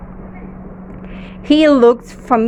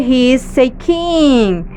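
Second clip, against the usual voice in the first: ordinary talk, nothing marked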